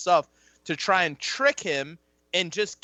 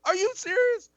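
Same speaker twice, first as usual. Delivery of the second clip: incredulous outburst. Retold or imitated.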